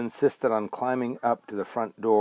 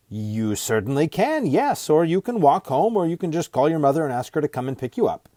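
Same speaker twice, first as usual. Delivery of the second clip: obliging stranger, reassuring; 6 semitones higher, 4 dB louder than usual. A narrator's reading. Man reassuring a wary girl, lightly acted and obliging. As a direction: rattle off the options helpfully.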